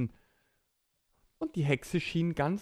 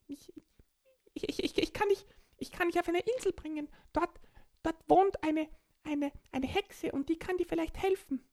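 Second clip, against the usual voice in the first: raised cartoon dolphin voice; faster than usual. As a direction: play a friendly dolphin. Dolphin character.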